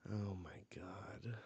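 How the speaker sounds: breathless whispered gasp of disbelief; a stunned real whisper; awed whisper. Real, not acted. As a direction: whisper it, overwhelmed. Stunned by the sad scene.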